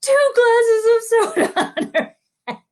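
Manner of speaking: very high, loud mock-tearful confession; blurted wail. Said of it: "then I blurted out".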